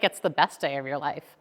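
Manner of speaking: low, soft reassuring words; calm husband. Retold or imitated. Husband calming his devastated wife, low and soft. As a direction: soothe her gently and quietly. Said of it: "And he's like".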